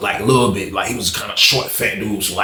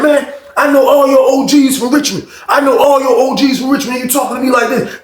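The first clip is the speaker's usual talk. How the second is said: higher, louder, indignant shouting; indignant yell; 10 semitones higher, 4 dB louder than usual. Retold or imitated. Johnson yelling at Tim about his Richmond elders, indignant. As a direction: throw his status back at him.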